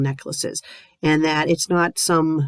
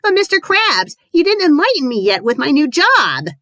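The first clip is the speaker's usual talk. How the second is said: whimpering SpongeBob; 9 semitones higher, 4 dB louder than usual. Acted. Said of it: "SpongeBob whimpered".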